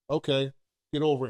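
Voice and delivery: low, quiet brush-off; dismissive father. Retold or imitated. Father refusing to own his wrong, imitated low dismissal. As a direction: shrug it off under your voice, pitch dropped. Voices father shrugging off blame.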